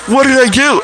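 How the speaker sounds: high-voiced mock protest of innocence; retold alarm. Blind man answering a fake cop, high mock alarm. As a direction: imitate a startled man, pitched high.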